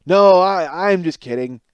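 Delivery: mock wife wriggling out of it, loud at first; Homer Simpson. Wife backtracks after the sandwich prank, Homer Simpson style. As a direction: blurt a loud backtracking denial, then drop to normal. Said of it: "she did this Homer Simpson move where she goes".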